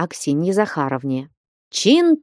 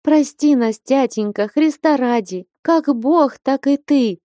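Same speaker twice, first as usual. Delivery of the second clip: higher, lamenting cry for forgiveness; wailing plea; 7 semitones higher than usual. A narrator's reading. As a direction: wail it up at father, begging. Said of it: "заголосила, наконец, Параша". (причитая, умоляя отца)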